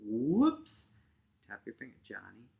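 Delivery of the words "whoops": WHOOPS swooping up high; ski-jump whoops. Shown as a demonstration. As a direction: let WHOOPS take off like a ski jumper. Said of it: "like you're doing a ski jump".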